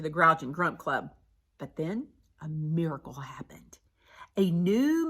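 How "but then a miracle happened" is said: soft, secret build to a miracle; hushed whisper. A narrator's reading. Narrator teases the miracle to listeners, whispering. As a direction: whisper the surprise.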